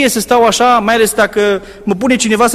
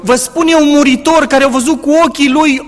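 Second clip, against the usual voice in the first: fervent preaching; 6 semitones higher, 4 dB louder than usual. Acted. Preaching at full force.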